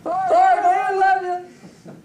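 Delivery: high, drawn-out holler; hammed-up high shout; mock yelling. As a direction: call it out as if from far off, stretching the last words. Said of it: "Instead of yelling".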